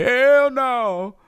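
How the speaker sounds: high, loud "Hell no" call; loud shout. Shown as a demonstration. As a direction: belt out "Hell no" like a game show buzzer. Shows how the game call sounds.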